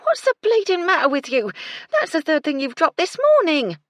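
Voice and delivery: loud, high, furious telling-off; angry scolding. A narrator's reading. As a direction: tear into her for her clumsiness. Cook scolds the maid.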